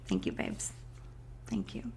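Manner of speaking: hushed, touched thank you; whispered thanks. Real, not acted. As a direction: barely whisper your thanks. Quiet thanks after emotion.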